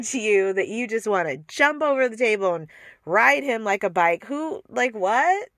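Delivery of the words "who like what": ordinary delivery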